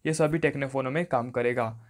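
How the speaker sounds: usual speaking voice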